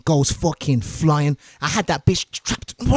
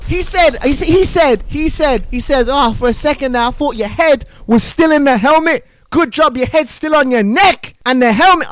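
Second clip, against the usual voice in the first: high and loud, tumbling out; animated high retold quote; excited retelling; 9 semitones higher, 5 dB louder than usual. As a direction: rush it out, barely believing it. Excitedly retelling boss's line.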